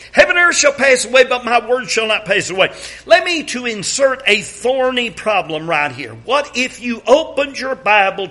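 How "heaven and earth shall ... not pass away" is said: brisk, raised solo recitation; fast solo reading. Shown as a demonstration. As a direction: rattle off the verse fast. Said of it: "allow me right here".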